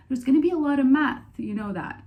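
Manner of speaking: high, loud retold warning; imitated brother's warning. Brother warning younger sibling about engineering, imitated warning. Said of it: "and then he told me".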